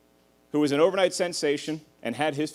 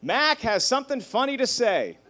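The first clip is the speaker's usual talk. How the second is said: higher announcement to the family; grandpa calling out; 9 semitones higher than usual. Retold or imitated. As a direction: call the room to order. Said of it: "he says".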